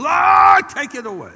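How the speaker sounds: high mimicked holler, loud start then easing; freight-train yell. Woman at the altar pleading with the Lord, imitated blasting yell. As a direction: blast the first word like a train in a tunnel. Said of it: "She sounded like a freight train in the tunnel".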